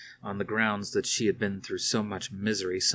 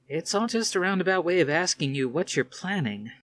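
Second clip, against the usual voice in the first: put-on lightly raised, sardonic young woman; young woman's voice; 9 semitones higher, 3 dB louder than usual. A narrator's reading. Athena needling her commander father, lightly voiced higher.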